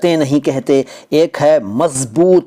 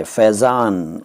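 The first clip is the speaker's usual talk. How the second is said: slow clear repeat; 5 semitones lower, much slower than usual. Shown as a demonstration. Teacher repeats Faizan slowly so viewers catch the z. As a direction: spell out Faizan slowly, each sound distinct. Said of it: "Faizan एक शब्द है".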